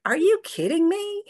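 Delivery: high, loud astonished "are you kidding me"; amazed disbelief. Retold or imitated. Husband hearing about the money, high astonished voice. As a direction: play the husband floored by the news. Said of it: "he was like".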